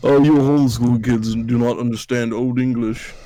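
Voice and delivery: a lightly mocking, snooty put-down; condescending. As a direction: talk down to the homeschool kids. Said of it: "I sound like a huge condescending asshole".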